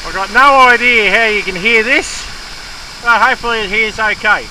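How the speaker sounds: loud, high shout to camera; calling over noise. Real, not acted. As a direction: shout to be heard over the water.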